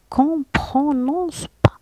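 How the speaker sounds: teacher stressing the rule; emphatic explaining. Shown as a demonstration. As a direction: drive home the point. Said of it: "stressing silent letter".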